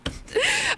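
high squealing laugh; shocked laughter. Real, not acted. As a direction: burst out laughing, mortified. Embarrassed by soundboard.

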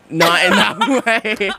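drawn-out words through laughter; laughing. Real, not acted. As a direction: burst out laughing while correcting yourself. Laughing off a double meaning.

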